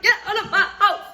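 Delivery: half-laughing, high, loud yell; retold shout. Throwing his stuff out, she yells with a laugh. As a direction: relive yelling him out the door, laughing. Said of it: "and I was like".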